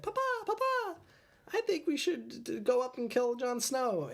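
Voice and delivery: very high, squeaky needy son voice; Ramsay impression. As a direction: pitch it way up like a whiny little boy begging his dad. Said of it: "that's my impression of Ramsay".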